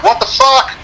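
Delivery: high startled question; lightly voiced incredulous cop; mocking the cop. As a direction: throw up your hands at the other driver. Imitating cop's hand gesture.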